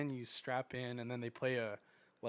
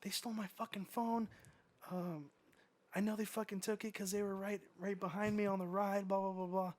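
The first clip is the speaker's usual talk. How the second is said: put-on high, whiny accusing girl voice; upset girl; 8 semitones higher than usual. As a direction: mimic the teen complaining to the manager. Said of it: "And the girl's like".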